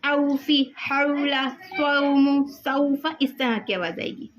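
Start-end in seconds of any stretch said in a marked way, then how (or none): 0.1-3.1 "औफी, हौला, सौमु, सौफ़ा" with a clear au vowel; au vowel demo. Shown as a demonstration. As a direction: open each word on a round "au". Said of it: "तो इनमें से औ की आवाज़ आएगी".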